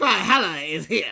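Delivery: low, ominous entrance line; dramatic intro voice. Retold or imitated. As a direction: intone it like a wrestling intro. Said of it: "Every time I hear".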